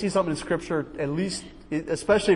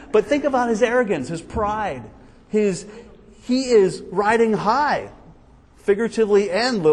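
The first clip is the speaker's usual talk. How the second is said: usual speaking voice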